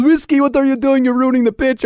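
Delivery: high, loud mock scolding of a horse; mock scolding shout. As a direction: yell it in imitated exasperation. Retells Arnold scolding his horse.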